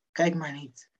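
normal talking voice

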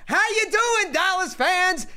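sarcastic hello to rival fans; mocking greeting. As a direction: needle the Dallas fans with fake friendliness.

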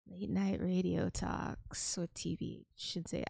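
hint of a sultry DJ; sensual radio voice. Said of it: "Let me try to sound sensual".

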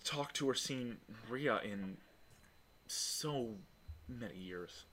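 halting, hushed apology fading out; apologetic. Acted. Alina breaks the hug with Adira, apologetic and hushed. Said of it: "she breaks it and goes".

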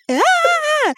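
high-pitched mock scream; imitated scream. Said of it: "And she screams".

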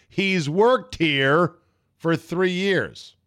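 mock-scolding, each word stretched out; imitating incredulous coworkers. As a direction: drag it out as an exasperated coworker's retort. Coworkers scolding him in retold exchange.

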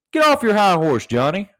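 loud scolding rant; shout. Real, not acted. Ranting at Johnny about his high horse, loud. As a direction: tell him off loudly.

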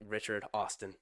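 ordinary talk, nothing marked

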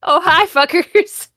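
high, loud, slow mock greeting; put-on greeting voice. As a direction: belt it like a cartoon goth.

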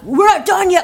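high, loud robber's warning; mock threat. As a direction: burst in with a retold threat. Acting robbers threatening hostages.

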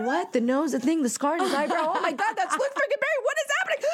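building to a high, incredulous squeal; retold starstruck shock. Fan recognizing the actor at her bedside, starstruck shock. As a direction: piece the face together, then freak out.

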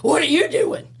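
mock a startled guard pulling her back; imitating the officer. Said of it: "and he's like".

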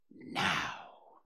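low, breathy, soft word; hushed and deliberate; hushed signal. A narrator's reading. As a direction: give the cue under your breath. Christopher Robin quietly gives the signal.

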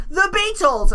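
high-pitched put-on British accent; hammy British. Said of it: "He's British".

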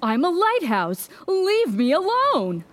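irritated retort rising high at the end; snappish. Acted. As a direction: snap back, wanting to be left alone. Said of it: "snapped Lawrence".

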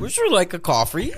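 botched impression in a high, silly voice; Yoda. Friend badly attempting an impression on request, high Yoda voice. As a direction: attempt the puppet sage and miss, pitched high. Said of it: "you're really bad at impressions".

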